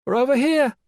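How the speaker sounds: high, loud call down the track; calling back. Acted. Mac guiding the officers in the dark, loud call. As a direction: call out to guide them in. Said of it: "Mac called back".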